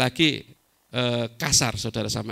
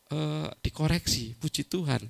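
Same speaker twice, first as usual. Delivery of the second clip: ordinary delivery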